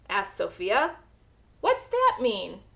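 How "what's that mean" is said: lightly voiced curious girl; a small puzzled child's question; little girl asking. Sophia asking her mother, lightly childlike.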